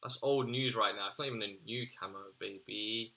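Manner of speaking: usual speaking voice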